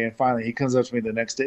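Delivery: ordinary talk, nothing marked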